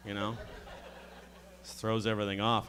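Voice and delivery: ordinary talk, nothing marked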